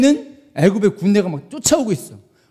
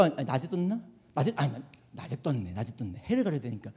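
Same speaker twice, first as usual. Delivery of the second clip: low, very soft thinking aloud; self-correcting aside; 8 semitones lower, 17 dB quieter than usual. Real, not acted. Preacher working out when the cloud appeared, self-correcting aside. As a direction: drop under your breath to sort it out. Correcting himself under his breath.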